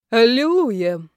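loud, slow, drawn-out exultation; obedient rejoicing. A narrator's reading. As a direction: play along with a booming mock hallelujah. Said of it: "послушно возликовал Колчиновский". (послушно ликуя, громко и протяжно)

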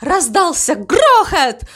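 high, slowing, suspenseful narration; dramatic build-up. A narrator's reading. Narrator follows the cow's leap, high and drawn-out excitement. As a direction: build excitement, stretching the words.

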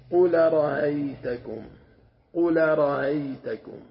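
"قل رأيتكم" slow, the hamza's vowel carried onto qul; Warsh reading demo. Shown as a demonstration. Teacher models the Warsh reading for students, slow recitation. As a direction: recite qul-ra'aytukum slowly, linking the vowel back. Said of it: "هذا قراءة ورش".

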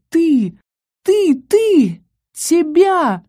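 high-pitched, drawn-out ghostly howl. A narrator's reading. Ghost at the girl it wants, high howl. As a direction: wail each word like a ghost. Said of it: "после чего он взвыл".

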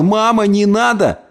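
high-pitched, anxious plea; pleading. A narrator's reading. Boy clings to his mother's hem, pleading. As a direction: beg her to stop. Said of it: "и умолял".

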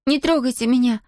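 put-on girl's anxious entreaty; pleading. A narrator's reading. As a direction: beg not to be hurt. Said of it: "взмолилась Зия".

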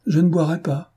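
put-on a young lady flatly refusing a drink; firm refusal. A narrator's reading. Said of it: "dit avec fermeté".

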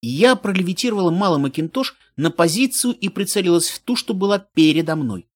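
ordinary delivery, nothing marked

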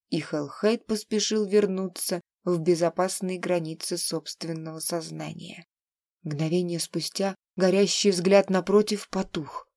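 plain reading voice

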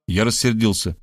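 ordinary delivery, nothing marked

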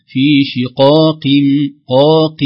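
slow, loud شقاق, first qaf full, second lighter; weight the first qaf of شقاق, ease the second; graded qaf heaviness. Shown as a demonstration. Teacher telling students to notice, graded qaf heaviness. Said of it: "ليس في مرتبة واحدة".

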